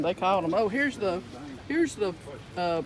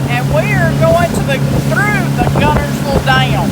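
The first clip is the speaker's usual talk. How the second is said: loud over boat noise; talking over the engine; 7 semitones higher, 16 dB louder than usual. Real, not acted. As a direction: raise your voice over the motor.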